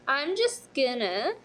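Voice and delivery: slow teasing reveal. Acted.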